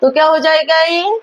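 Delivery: ordinary talk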